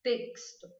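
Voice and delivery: "Texto" with the X said as KS; KS sound. Shown as a demonstration. Teacher giving students a word to repeat. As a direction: model "Texto" clearly for repetition. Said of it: "Repete aí pra você aprender".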